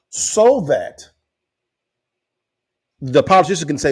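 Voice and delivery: ordinary talk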